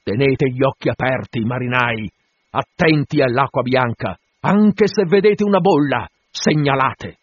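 loud, rising call to the crew; captain rousing his sailors; shouted orders. Acted.

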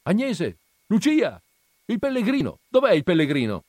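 high, loud calling into the house; calling out names. Acted. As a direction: search an empty house by voice. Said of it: "Si chiama".

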